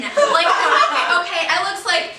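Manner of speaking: normal talking voice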